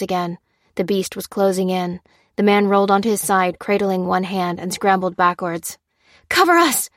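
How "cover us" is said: much higher, loud urgent cry; shouted command. A narrator's reading. Lucy crying to her squad to cover them, loud and high. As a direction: yell it to your squad. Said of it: "cried Lucy".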